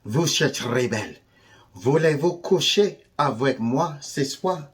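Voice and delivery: put-on French accent. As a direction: play at a French flirt. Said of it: "I guess those are French words".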